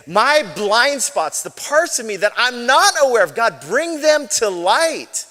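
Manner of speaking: earnest prayer lifted high. Acted. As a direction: cry out to God, slowly. Prays a line aloud.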